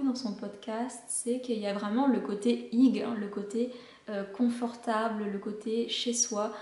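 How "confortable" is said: normal talking voice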